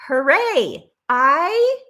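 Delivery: bright, loud cheer of hooray; cheery hooray. Shown as a demonstration. Host showing viewers how to post, cheering hooray. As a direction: cheer it like good news. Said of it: "Type in the comments".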